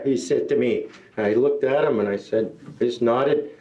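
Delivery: ordinary talk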